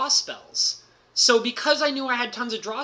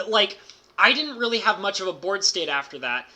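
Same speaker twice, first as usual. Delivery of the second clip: ordinary talk, nothing marked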